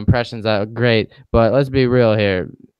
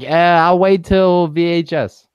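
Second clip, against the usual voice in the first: slow, drawn-out dismissive shrug; lazy shrug; 6 semitones higher, 4 dB louder, slower than usual. Retold or imitated. To cohost about skipping movies, slow lazy shrug. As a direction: brush it off slowly, no hurry. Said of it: "I'm like".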